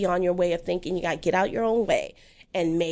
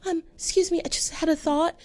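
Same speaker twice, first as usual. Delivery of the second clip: higher, hesitant voice asking permission; timid woman in a sketch; 7 semitones higher than usual. Retold or imitated. Woman in a comedy sketch timidly asks to speak, higher and hesitant. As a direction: play a woman apologising for speaking up. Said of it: "they were doing this thing".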